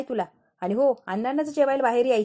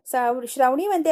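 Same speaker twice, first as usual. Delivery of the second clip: plain reading voice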